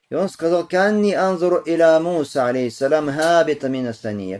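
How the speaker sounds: high, loud, ringing delivery; hadith recitation. A narrator's reading. Recites and translates hadith aloud.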